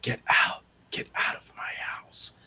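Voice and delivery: slow, wispy whisper; wispy ghost whisper. Retold or imitated. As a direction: breathe it out like a ghost. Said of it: "kinda slow and wispy".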